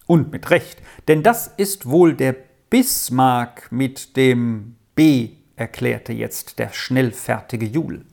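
ordinary delivery

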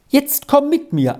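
high-pitched excited summons; calling out. A narrator's reading. Squirrel fetches Arthur upstairs, calling out. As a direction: call out eagerly to a playmate. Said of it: "rief sie".

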